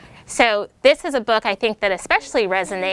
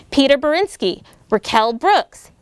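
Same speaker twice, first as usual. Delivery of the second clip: lively storybook read-aloud voice; bouncy rhyming picture-book reading; reading to children; slower than usual. A narrator's reading. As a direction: read it like story time for second graders.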